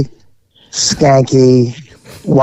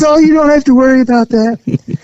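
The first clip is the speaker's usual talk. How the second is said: high raised mock-innocent reassurance; imitating the woman; 11 semitones higher than usual. The woman assuring the cop the house is empty, raised and too eager. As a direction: chirp nobody's here, too eager. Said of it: "And she goes".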